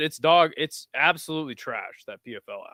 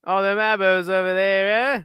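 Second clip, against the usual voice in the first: lightly faked Australian announcer accent; a hint of an Australian accent, mocking; imitating the announcer; 5 semitones higher, 5 dB louder than usual. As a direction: mimic the announcer in a loose Aussie accent. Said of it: "saying Abo is like".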